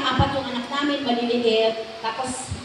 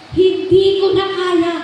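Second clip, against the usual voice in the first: crying; 4 semitones higher, 7 dB louder, slower than usual. Retold or imitated. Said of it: "Umiiyak siya".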